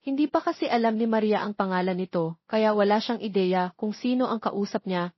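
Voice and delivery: ordinary delivery, nothing marked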